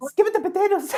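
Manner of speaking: very high, loud Irish squeak; mock the Irish catchphrase; shrill copycat Irish accent; Irish mimicry. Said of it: "And Irish is like".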